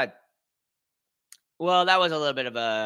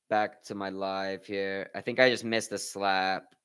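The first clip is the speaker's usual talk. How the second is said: ordinary talk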